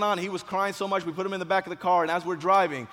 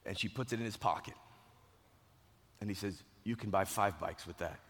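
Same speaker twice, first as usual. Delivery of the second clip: low, soft, tender storytelling; hushed, moved telling; 7 semitones lower, 7 dB quieter than usual. Retold or imitated. Speaker telling of cash given to injured boy, hushed. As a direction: let the voice sink low and quiet.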